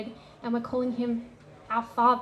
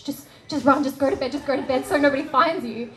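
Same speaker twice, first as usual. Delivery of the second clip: louder, fast, panicky push to flee; frantic urging; 2 semitones higher, 6 dB louder than usual. Retold or imitated. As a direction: hurry them off to bed. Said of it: "you freak out with them".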